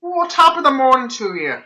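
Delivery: high, hammed-up Irish brogue; stage Irish lilt; Irish accent. Irish stereotype joke.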